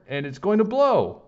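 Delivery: breathless, high and loud; panting alarm. Acted. Boy warning the principal out of breath, panting loud. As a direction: gasp out the warning. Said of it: "I panted".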